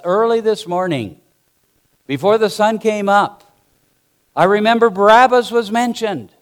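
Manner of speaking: loud, high, impassioned preaching; full-force preaching. Acted. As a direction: preach it out to the whole congregation.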